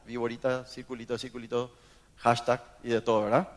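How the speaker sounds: ordinary talk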